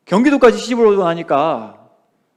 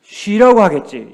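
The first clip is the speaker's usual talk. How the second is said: 쉬라고 louder and slower, falling; slow emphatic 쉬라고 하겠지, louder; stress on 쉬라고; 5 dB louder, slower than usual. Shown as a demonstration. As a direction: answer the question by landing on 쉬라고. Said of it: "뭐라고 하겠지?".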